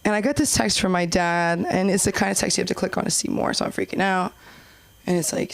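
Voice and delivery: usual speaking voice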